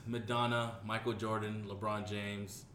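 ordinary delivery

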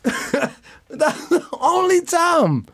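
very slow, laughing through words. Real, not acted. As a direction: crack up mid-sentence. Cracking up at his own joke.

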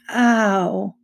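drawn-out "ow"; pained. A narrator's reading. Boy dazed after the car crash, pained. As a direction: groan it out after a knock.

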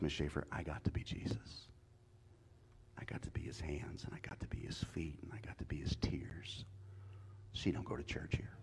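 soft, unvoiced, moved testimony; preacher whispering his testimony, deeply moved; hushed whisper. Real, not acted. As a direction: whisper it as if holy.

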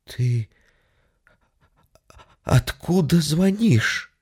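slow, timid sister-in-law; frightened woman's voice. Acted. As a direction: ask in a scared hush. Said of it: "испуганный женский голос". (испуганный женский голос)

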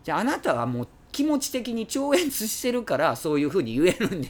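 loud, high, sneering challenge; mocking accusation. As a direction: throw the accusation back at him. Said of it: "みたいな".

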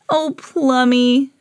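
slow, fond reassurance; Emma. A narrator's reading. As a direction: draw the pet name out lovingly. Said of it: "said Emma".

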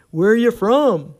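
put-on Texas accent, friendly and loud. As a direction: call it out like a folksy Texan. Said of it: "do a better Texas accent".

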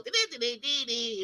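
high beeping imitation of an electronic game; mock electronic beep noises; handheld game beeps. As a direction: chirp it like a toy football game. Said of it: "it made that little noise".

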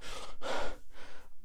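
a long, tired exhale; a dying fighter's sigh; weary sigh. Retold or imitated. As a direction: sigh like a man on his last breath. Johnny's dying breath acted.

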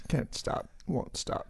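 breathy whispered catchphrase; whispered motto. Real, not acted. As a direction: whisper it like a guilty confession. Playful motto about his glass collection.

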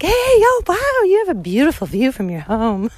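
high, bright chirpy greeting to a passerby; sudden sugary friendliness; sweet friendly switch. Shown as a demonstration. Switching from rage to sweet greeting a Spanish man. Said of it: "how easily I can switch from, like, fucking raging to".

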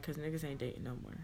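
normal talking voice

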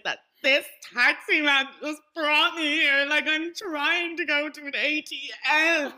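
put-on high, loud, slow drunk whine; drunken complaint. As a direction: plead it slurred to the guards. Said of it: "I was like".

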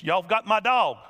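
loud challenge from the porch; retold holler. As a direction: call them out, loud and firm. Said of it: "he began to holler".

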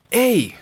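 "Hey" said as English "ay"; English letter A. Shown as a demonstration. Teacher showing students English A, "Hey" said as "ay". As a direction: say "Hey" like a call, ending in a glide. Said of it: "en inglés la letra A se dice".

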